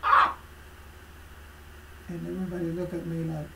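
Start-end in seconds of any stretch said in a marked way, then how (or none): 0.0-0.4 retold shout. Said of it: "she told me".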